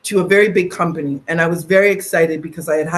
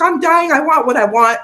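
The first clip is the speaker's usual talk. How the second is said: imitating mom; 9 semitones higher, 4 dB louder than usual. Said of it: "she's like".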